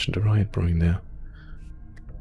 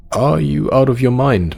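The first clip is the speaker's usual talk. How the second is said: louder, higher, incredulous challenge; indignant outburst; 6 semitones higher, 6 dB louder than usual. Acted. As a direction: fire it out as an outraged refusal. Character indignantly refuses to go.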